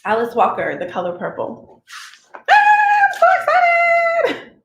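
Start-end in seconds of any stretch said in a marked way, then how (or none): 2.5-4.5 high, loud burst of excitement; excited squeal. Real, not acted. Thrilled to start the book with viewers, squealing. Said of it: "So excited to read this".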